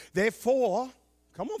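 ordinary talk

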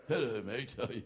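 mock British accent; Brian Houston, pommy version. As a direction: imitate a bad British take on an Australian preacher. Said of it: "he sounds like a pommy version of Brian Houston".

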